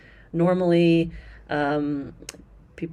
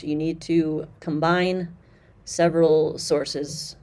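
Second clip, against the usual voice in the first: slow emphasis; slower than usual. Real, not acted. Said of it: "slowed for emphasis".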